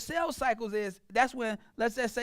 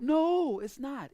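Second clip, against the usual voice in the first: ordinary talk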